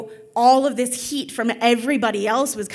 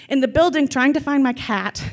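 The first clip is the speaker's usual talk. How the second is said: normal talking voice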